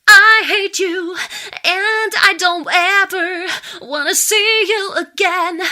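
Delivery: loud, high, furious shout; angry cry with a sharp breath; angry outburst. Shown as a demonstration. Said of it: "see how the breath changes".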